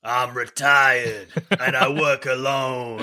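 slow, weary canteen lady voice; gruff lunch lady. Retold or imitated. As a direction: drag it out like a tired lunch lady. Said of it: "Why does he sound like a lunch lady?".

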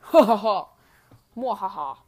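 evil laugh. Acted. Jokes viewers must accept it.

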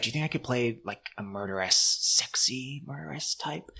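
sultry whisper. Retold or imitated. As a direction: breathe it like a femme fatale. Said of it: "Rinna's like".

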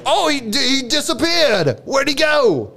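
high-pitched comic amazement; astonished. Acted. Australian watching friend vanish, amazed. Companion has just vanished.

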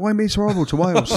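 high, pleading mock outrage; mock-indignant plea. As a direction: whine it at him like he's being cruel. Teasing co-host about negativity.

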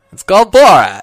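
loud, high shout of the title. Acted. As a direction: yell the title out.

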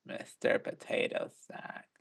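soft, slow, breathy rasp; dark gritty voice. Shown as a demonstration. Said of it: "It was a bit more like".

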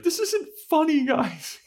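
high panicky squeal; scared Anya. Retold or imitated. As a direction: squeal it, terrified of the bunny. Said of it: "She's like".

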